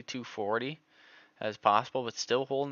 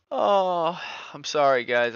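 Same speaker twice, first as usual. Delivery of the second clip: excited apology; 7 semitones higher, 7 dB louder, slower than usual. Real, not acted. Apologising after loud outburst.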